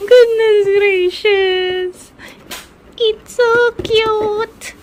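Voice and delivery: very high, loud, slow gushing squeal; over-the-top squeal. Acted. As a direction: gush like a starstruck fan. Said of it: "Ang arte-arte ko naman".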